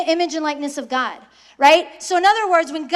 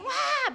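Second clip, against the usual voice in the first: a short, high, soft mock baby cry; imitated baby wail; 10 semitones higher, 8 dB quieter than usual. Teacher imitating a newborn crying at night, high mock wail. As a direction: squeak out a newborn's hungry wail.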